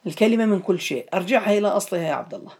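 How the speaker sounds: ordinary talk, nothing marked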